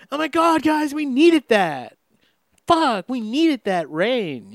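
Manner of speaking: gushing Angeleno. Retold or imitated. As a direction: ham up a thrilled LA local. Mocking gushing Angelenos about rain.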